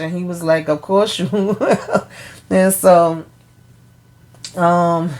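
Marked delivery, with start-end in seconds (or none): 0.7-2.1 slow quote breaking into laughter; laughing retold reply. Recapper quotes Hunter's reply to a slap threat, laughing. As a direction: draw the words out and laugh through them.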